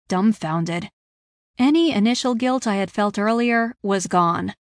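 ordinary delivery

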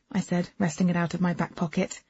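plain reading voice

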